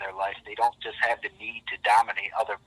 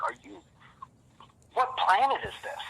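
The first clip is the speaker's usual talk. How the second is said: incredulous. Retold or imitated. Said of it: "I'm like".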